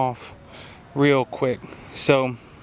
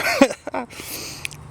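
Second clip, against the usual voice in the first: high unvoiced laugh; 12 semitones higher than usual. Real, not acted. Reacting to sour berry.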